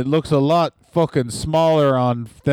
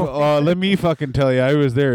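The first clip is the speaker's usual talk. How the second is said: normal talking voice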